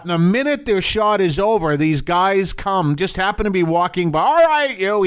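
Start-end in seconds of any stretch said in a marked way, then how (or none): 4.2-5.0 brash New York street voice, high and loud; a New York tough shooing people off; New York accent. Retold or imitated. New York accent from cops moving the crew along. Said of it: "you know, that New York accent".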